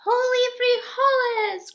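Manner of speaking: high, loud battle shout; the little hero crying out as he strikes; triumphant cry. Acted. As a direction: yell it as the sword thrusts up. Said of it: "cried Scipito".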